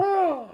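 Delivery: high squawk like a seagull; seagull cry. Acted. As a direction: screech it out like a gull. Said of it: "Is that a good seagull impression?".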